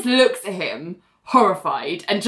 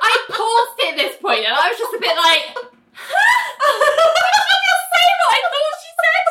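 high squeal through helpless laughter; real cracking up, pitch soaring; laughing disbelief; 3 dB louder than usual. Real, not acted. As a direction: barely get it out for laughing. Cracking up retelling own disbelief.

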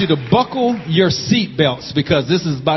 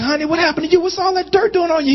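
a higher, lightly voiced fussing wife; worried wife; 8 semitones higher, faster than usual. Wife greeting her dirty husband, mildly voiced worried question. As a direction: mildly act out the wife's concern. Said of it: "his wife was like".

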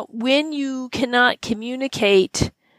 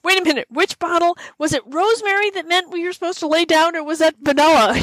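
higher, flustered mock dog owner; confused owner; 8 semitones higher than usual. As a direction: play a lost owner fumbling bottles. S1 plays confused owner with scents.

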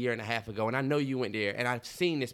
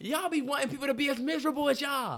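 lightly voiced Will Smith, pitched up; a hint of Will mocking the haters; imitating Will Smith; 10 semitones higher than usual. Friend retells Will Smith's comeback in a high mock voice. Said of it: "Will said".

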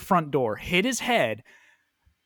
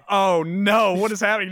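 mock dismay; 5 semitones higher, 5 dB louder than usual. Retelling his horror at the scene.

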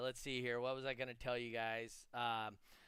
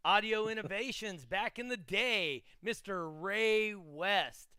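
big, slow, louder hype announcer; announcer intro; 5 semitones higher, 5 dB louder, slower than usual. Pretend. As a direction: introduce him like a boxing ring host. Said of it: "I'm gonna use that as my lead-in".

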